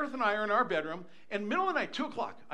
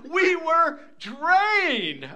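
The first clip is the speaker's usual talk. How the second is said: DRAINED stretched very slow, high and loud; exaggerated emphasis; 8 semitones higher, 9 dB louder, much slower than usual. Real, not acted. Grandpa telling congregation about exhaustion, DRAINED loudly drawn out. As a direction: hammer "drained" with a long draw-out.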